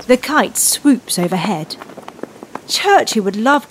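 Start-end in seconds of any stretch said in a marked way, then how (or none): none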